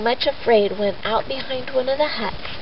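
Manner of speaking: ordinary delivery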